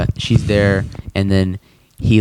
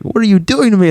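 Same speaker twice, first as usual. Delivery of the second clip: high flustered Morty cry; hammed-up high Morty; 11 semitones higher, 3 dB louder than usual. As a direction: squeak it out in panic. Retelling Morty's voice.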